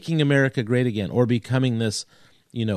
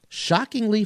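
mock shock; 10 semitones higher than usual. Ironic self-aware announcement of topic.

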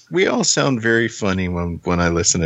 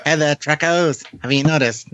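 put-on old-timey voice; 9 semitones higher than usual. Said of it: "in my old-timey voice".

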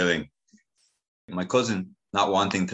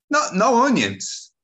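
much higher, slow, shocked auntie squeal; octave higher; 6 dB louder, slower than usual. Retold or imitated. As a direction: jump way up in pitch, aghast. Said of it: "My auntie's voice raised an octave or two".

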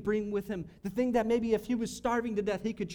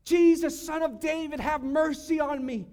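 raised plea, higher in pitch; crying out; 5 semitones higher, 5 dB louder than usual. Retold or imitated. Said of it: "he is crying out".

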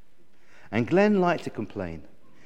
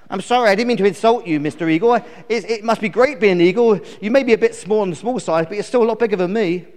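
fast, high, loud insect character; flustered little bluebottle; apologetic fly; 6 semitones higher, 6 dB louder, faster than usual. Acted. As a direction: gabble a placating apology.

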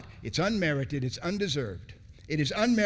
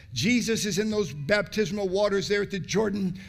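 normal talking voice

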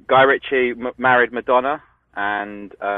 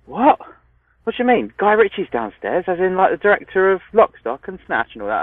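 high, loud burst of disbelief, easing at the end; astonished; 10 semitones higher, 4 dB louder than usual. Retold or imitated. Teenage son to dad on learning who is downstairs, astonished. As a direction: react with amazed, pitched-up questioning. Retelling teenage self's amazed reaction.

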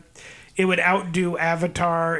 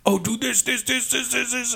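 mocking studio bosses; 7 semitones higher than usual. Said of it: "they were just like".

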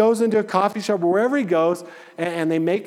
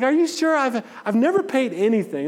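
surprised disbelief; 7 semitones higher than usual. Retold or imitated. Pastor retelling shock at the car shop, incredulous. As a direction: pitch jumps up high in disbelief.

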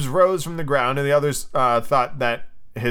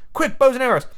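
high, urgent storybook cry; acted boyish urgency; urgent boys; 10 semitones higher than usual. A narrator's reading. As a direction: call the others to arms in a high voice. Boys rushing to arm themselves.

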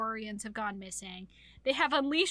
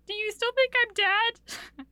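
high, loud, drawn-out acted question; plaintive mock question; 10 semitones higher, 6 dB louder than usual. Heroine asking her mate after crying, high plaintive question. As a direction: play her pleading, pitched way up and slow.

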